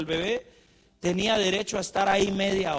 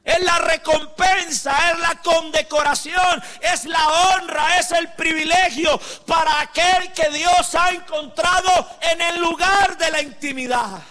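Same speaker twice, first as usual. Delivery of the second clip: loud, high-pitched preaching shout; preaching at full force; 9 semitones higher, 9 dB louder than usual. Acted. Preacher proclaiming to the congregation, full-force shouting sermon. Preacher addresses intercessors loudly.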